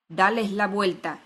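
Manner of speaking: loud, slow, angry command; furious order. A narrator's reading. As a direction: give the order in a controlled fury.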